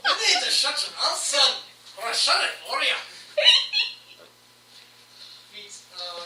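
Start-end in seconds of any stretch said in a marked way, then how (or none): 0.1-3.0 high, loud, fast laughing threat; tough gnome threat. Acted. Gnome threatening the fighter, laughing loud taunt. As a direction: threaten him through laughter. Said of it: "S0 repeats quote".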